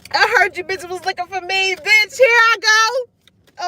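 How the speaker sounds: high, loud mock challenge, building; cocky call-out. Answering those who were looking for you, cocky loud holler.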